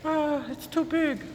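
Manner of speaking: slow, high falsetto whine; mock him in a drawn-out squeaky voice; roommate impression. Roommate trying on the coat, mock falsetto complaint. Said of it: "tries it on, he's like".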